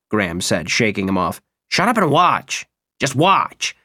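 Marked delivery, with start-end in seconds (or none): 1.7-3.8 angry snap. Acted. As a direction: snap at him to shut up. Graham snaps at Barnes.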